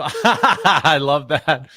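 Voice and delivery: real laughter all through the words, slow; said while still laughing at the Barbecue name. Real, not acted. Laughing at a reader's comment.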